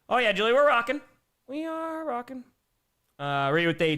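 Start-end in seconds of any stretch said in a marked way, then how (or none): 0.1-2.4 high, excited cheer for the chat; hyped up. Real, not acted. Streamer answering Julie that they're rocking, hyped up. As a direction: pitch it up and stretch the rocking.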